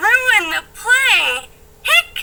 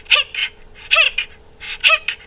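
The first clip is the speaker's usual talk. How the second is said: hiccups; 11 semitones higher than usual. Acted.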